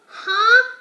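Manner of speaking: high, loud puzzled huh; questioning huh. A narrator's reading. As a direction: throw a baffled huh up high. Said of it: "A question mark can be huh".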